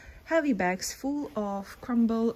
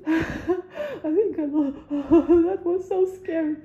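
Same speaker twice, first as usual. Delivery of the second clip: laughing and half crying, higher and louder; nervous laughing cry; 7 semitones higher, 5 dB louder, slower than usual. Real, not acted. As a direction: laugh the fear out, near tears. Nervous laughing after real fright.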